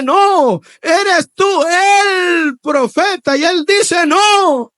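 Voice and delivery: loud, high, drawn-out shout; shouted dialogue. Acted. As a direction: bellow the exchange at full force. Preacher acting out the dialogue. (diálogo gritado con fuerza)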